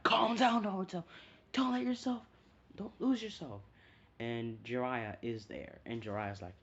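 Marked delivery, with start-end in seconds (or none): 0.0-3.6 high, loud urgent plea in a put-on voice; fox spirit calming. Kurama calling Naruto back from his rage, pleading. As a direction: pull him back from the edge. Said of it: "And Kuroma's like".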